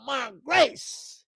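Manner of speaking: ordinary delivery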